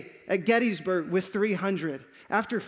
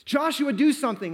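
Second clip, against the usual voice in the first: high, raised plea; yelling; 6 semitones higher, 4 dB louder than usual. Retold or imitated. Brothers beg Joshua to act, yelling. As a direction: cry out for him to act. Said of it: "and they're yelling".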